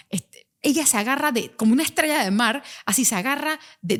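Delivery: ordinary delivery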